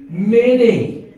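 Many loud and falling, held long; preacher correcting the church, firm stress. Shown as a demonstration. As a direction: hit Many hard. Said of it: "No, will deceive how many?".

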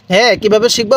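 ordinary talk

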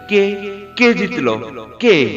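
eager, gleeful king's questions; excited, punchy questioning; undisguised glee. Acted. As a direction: burst out asking, unable to hide delight. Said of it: "খুশি গোপন না করে".

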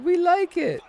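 put-on high, squeaky child voice, chirpy; little daughters' voice. Dad voicing his daughters saying they liked the scary store, high and squeaky. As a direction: pitch way up like his little girls. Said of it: "And then they said".

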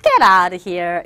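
teasing get lost; dismissive, joking. Shown as a demonstration. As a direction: brush off a bad joke. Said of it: "억양이 좀 달라지죠".

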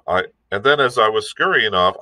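ordinary delivery, nothing marked

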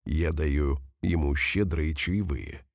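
ordinary talk

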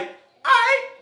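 high, thin squeak on all right; squeaky concession. Acted. Preacher voicing Nathanael giving in, high squeak. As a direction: pitch it way up in mock surprise.

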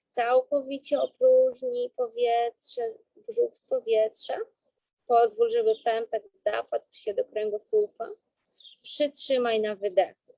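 slow, calm breathing-coach voice; guided breathing. Acted.

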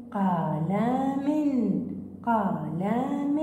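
drawn-out قلم, each sound stretched; slow syllable-by-syllable قلم; slow blended reading. Shown as a demonstration. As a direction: blend the word slowly for pupils. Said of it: "والإم،".

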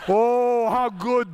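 laughing, high and loud oh how good; delighted relief. Retold or imitated. Leaders cheering Lula's offer, laughing delight. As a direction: gush with happy surprise. Leaders hear Brazil has money.

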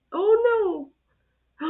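high, drawn-out wail of dismay; dismayed cry. Real, not acted. Reacting to a mishap on video, dismayed cry.

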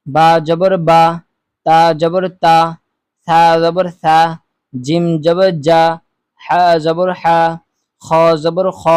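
steady, measured drill voice, each syllable called out; recitation. Shown as a demonstration. Teacher leading viewers through the zabar drill, measured recitation.

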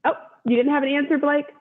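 ordinary delivery

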